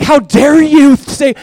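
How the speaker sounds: loud, high, drawn-out indignation; mock outrage. Confronted man getting mad at the pastor, mock outrage. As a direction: act out an offended outburst. Voices the anger he expected.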